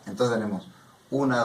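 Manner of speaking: ordinary talk, nothing marked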